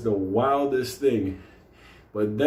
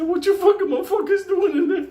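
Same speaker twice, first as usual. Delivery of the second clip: high, loud, laughing shout at them; hammed-up yell; 5 dB louder than usual. [name] yelling through the door at the men, high and laughing.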